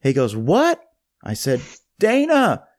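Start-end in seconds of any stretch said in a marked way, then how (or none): 0.4-0.8 high, loud, incredulous mock question; East Tennessee principal. Principal asking a student to repeat his name, loud and high. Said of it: "in this very drawn out East Tennessee accent". 2.0-2.6 high, loud repeat of a name; raised voice. Retold or imitated. New student repeating his name to the principal, high and loud.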